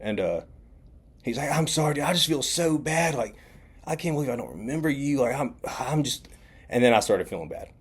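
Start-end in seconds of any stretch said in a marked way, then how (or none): 1.5-6.3 higher-pitched imitation of remorse; guilty apology. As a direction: act out feeling bad for forgetting. Stranger feeling bad, apologising.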